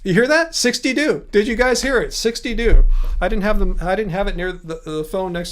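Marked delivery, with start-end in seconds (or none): 0.6-1.3 "Sixty-two" with TWO said as "doo"; mimicked mispronunciation. Streamer repeats the phone's glitch to viewers, mimicked mispronunciation. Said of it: "Do versus two is apparently". 2.1-2.9 "Sixty-two" said loudly as "sixty-doo"; mimicked mispronunciation. Streamer checks viewers heard the phone, mimicked mispronunciation. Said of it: "Do versus two is apparently".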